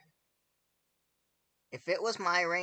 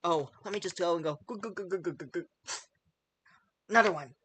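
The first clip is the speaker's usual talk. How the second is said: mock chugger; 2 semitones higher, 4 dB louder, faster than usual.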